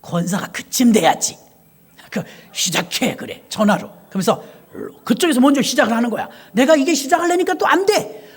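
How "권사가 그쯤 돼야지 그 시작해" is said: put-on old deaconess voice. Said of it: "그쪽에서 딱 시작을 해줘".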